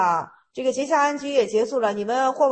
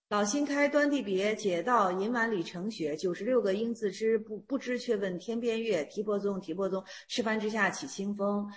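verse recitation; 4 semitones lower, 8 dB quieter than usual. Acted. Verse read a second time.

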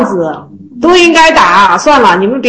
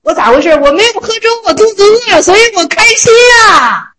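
cook's gleeful outburst, higher and rising to a shout; excited cook; 7 semitones higher than usual. Retold or imitated. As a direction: blurt it out like a hungry cook overjoyed. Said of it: "火头就说了". (火头欢喜地高声嚷出)

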